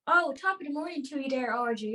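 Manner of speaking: stock stage-Irish greeting; Irish accent. Shown as a demonstration. Host tries out an accent for Audrey, playful stage Irish. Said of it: "What about an Irish accent?".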